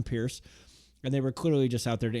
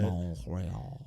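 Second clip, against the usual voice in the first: mock French accent; 8 semitones lower, 8 dB quieter than usual. Repeats the mock French name.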